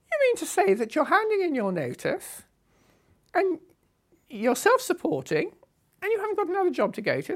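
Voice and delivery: a loud, high imitation of the boss's astonishment; incredulous boss. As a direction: press her as if she's mad.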